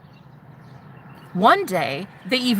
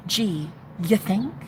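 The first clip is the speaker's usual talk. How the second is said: sarcastic; 2 semitones lower, 5 dB quieter, slower than usual. Retold or imitated. Sarcastic reply to herself.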